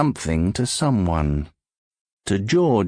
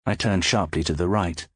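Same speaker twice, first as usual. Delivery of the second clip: plain reading voice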